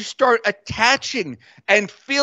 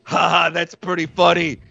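low, hammed-up Brooklyn Italian accent; mock Brooklyn Italian; 8 semitones lower, faster than usual. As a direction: robots imitating old-neighborhood Brooklyn Italians. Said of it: "start going like".

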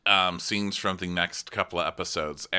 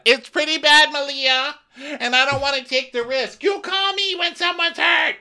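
hammed-up shrill yell; 8 dB louder than usual. Captain yelling at Malia.

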